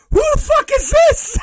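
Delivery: very high, loud, incredulous squeal; laughing shriek. Real, not acted. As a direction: shriek it while cracking up. Cracking up in bafflement.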